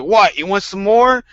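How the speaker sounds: high, loud cocky challenge to the bear; mock tough taunt. Old man taunting the bear he punched, high cocky challenge.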